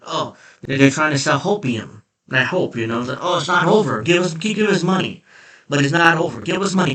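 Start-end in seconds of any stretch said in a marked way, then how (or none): 3.2-6.9 mocking party fundraisers, pitched up and louder; higher, louder mock hopeful sales pitch. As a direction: parody an insistent plea for donations. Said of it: "what the party's doing here is like".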